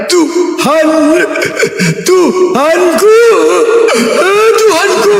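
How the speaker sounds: high, slow, drawn-out wailing; hammed-up cry of a forsaken man; mock anguished cry. Mocking Jesus calling out on the cross, high wailing. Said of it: "Kesakitan itu kan?".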